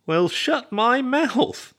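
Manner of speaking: very high, drawn-out amazement; exclaiming. A narrator's reading. Roommate learns of the new match, exclaiming. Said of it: "Ernie exclaimed".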